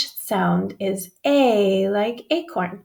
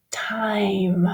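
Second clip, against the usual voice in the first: a voiceless whisper of Time; whispered word. Shown as a demonstration. Teacher modelling the word for children, whispered.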